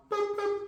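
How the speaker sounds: high soft boop boop; a little high dismissal beep; boop sound effect. Acted. Players cut from the roster, soft high boop.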